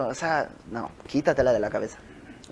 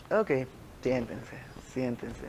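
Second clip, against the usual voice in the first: much softer, lightly voiced patient teacher; acted calm dismissal; 2 semitones lower, 12 dB quieter, slower than usual. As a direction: quietly settle down overexcited followers. Jesús responde a los discípulos exaltados. (imitando una orden tranquila, en voz baja)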